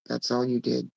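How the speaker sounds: normal talking voice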